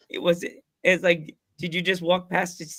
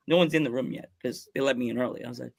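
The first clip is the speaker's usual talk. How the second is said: ordinary delivery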